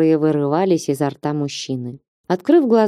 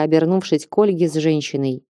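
ordinary delivery, nothing marked

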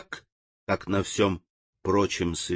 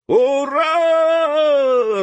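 long, high, loud hurrah; cheering; 5 dB louder than usual. A narrator's reading. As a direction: shout it in triumph. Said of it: "разом крикнули все".

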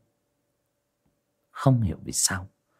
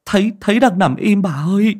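high, louder stammering servant; frightened stammer; 10 semitones higher, 4 dB louder than usual. Acted. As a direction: stutter out the news. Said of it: "lắp bắp".

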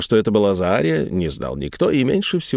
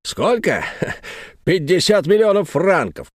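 mocking; 7 semitones higher than usual. A narrator's reading. Antifer names huge price.

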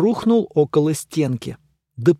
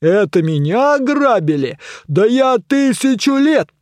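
indignant bellow; 7 semitones higher, 4 dB louder than usual. A narrator's reading. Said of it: "возмущённо завопила басом".

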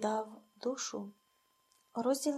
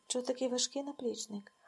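usual speaking voice

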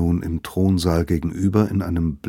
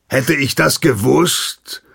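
loud, high mocking jab with a laugh; sarcastic retort; 7 semitones higher, 6 dB louder than usual. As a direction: sneer while pretending to apologise. Hagen's sarcastic reply.

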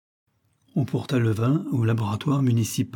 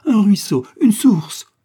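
much higher and louder, frantic; feverish cry; 10 semitones higher, 9 dB louder, slower than usual. A narrator's reading. Widow listing every source of water, frantic cry. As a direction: cry out each word with rising hope. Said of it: "dit-elle alors".

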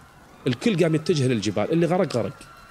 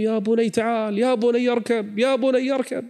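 higher, urgent pleading calls; father calling out; 9 semitones higher than usual. Acted. Noah calling his son again, pleading. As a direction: keep calling the son to come.

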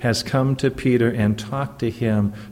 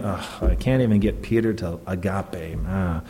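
lower, deflated mock sigh; imitating a disappointed teacher who settles for less; mock resigned sigh; 4 semitones lower, 4 dB quieter than usual. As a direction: play Jesus giving up on Peter, mock-resigned. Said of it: "This is not Jesus going".

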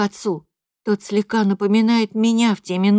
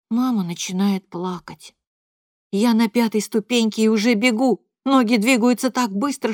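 usual speaking voice

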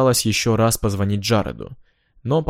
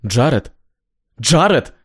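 yelling into the phone; 3 semitones higher, 5 dB louder, slower than usual. Acted. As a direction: shout his name in fury. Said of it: "в ярости".

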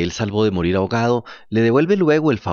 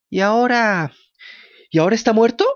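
high, loud, full of grief; anguished cry; 10 semitones higher, 3 dB louder than usual. A narrator's reading. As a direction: blurt it out in pain. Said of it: "interrumpió Pinocho con inmenso dolor".

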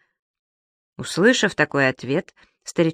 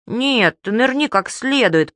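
put-on small child's higher-pitched demand; 7 semitones higher, slower than usual. A narrator's reading. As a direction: insist on it like a little kid. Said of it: "потребовал веснущатый карапуз". (требовательно, как карапуз)

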